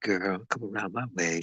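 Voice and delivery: ordinary talk, nothing marked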